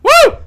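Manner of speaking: high startled woo shriek; a sudden loud yelp of fright; scream into giggles. Real, not acted. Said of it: "You scared me".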